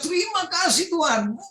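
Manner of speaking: high-pitched, mock yell without extra volume; retold cry of thanks. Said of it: "Saya teriak".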